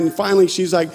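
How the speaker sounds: ordinary delivery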